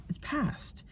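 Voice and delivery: breathy whispered close; a soft whisper of relief. Real, not acted. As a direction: whisper it's over. Ending crying season.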